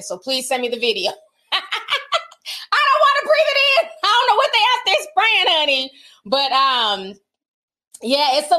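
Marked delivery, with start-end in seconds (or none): 1.5-5.6 mock panic. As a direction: squeal it in comic fear. Mock-panicked about alien spray.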